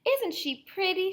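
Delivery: put-on high, sweet girlish voice; Little Red voice. Wolf's reader voicing vain Little Red, high and sweet. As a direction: chirp it like a vain little girl.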